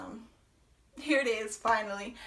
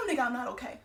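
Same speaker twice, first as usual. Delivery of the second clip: ordinary talk, nothing marked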